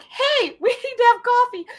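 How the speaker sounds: high bright laughing singsong; mock cheery boss. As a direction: chirp it out, hiding the worry. Boss calls in unhappy employee.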